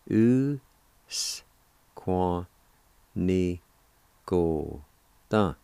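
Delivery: "U, S, Kwa, Ni, Ko, Ta", isolated sounds with pauses; syllable by syllable. Shown as a demonstration. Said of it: "It's pronounced like this".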